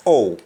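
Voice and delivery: "Oh" meaning how, without any h; Jamaican dropped h. Shown as a demonstration. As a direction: start "Oh" straight on the vowel. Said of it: "We simply say oh".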